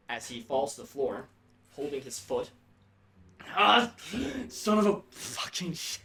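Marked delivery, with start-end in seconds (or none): 3.5-6.0 yelping curse after being shot; high pained shout; pained yell. Acted. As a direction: cry out in sudden pain then swear. Arden shot in the foot.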